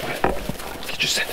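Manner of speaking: hushed whisper to the dog; soft coaxing whisper. Acted. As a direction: whisper it like a secret.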